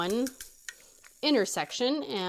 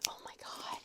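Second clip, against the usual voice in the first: breathless whisper; a hushed oh my God; 18 dB quieter than usual. Retold or imitated. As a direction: mutter it under your breath in disbelief. Reacting to pedestrians in lot.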